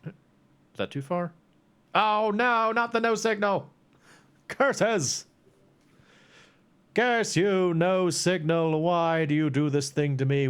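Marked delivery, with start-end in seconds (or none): 1.9-10.5 mock dramatic outrage. Mock curses at lag.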